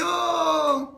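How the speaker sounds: teacher marvelling at triplets in her school; gushing teacher. Acted. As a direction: coo like an amazed schoolmistress. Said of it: "elle dit".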